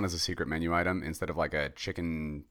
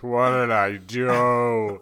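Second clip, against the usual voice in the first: mock despair; 5 semitones higher, 8 dB louder, much slower than usual. Acting morning-after regret.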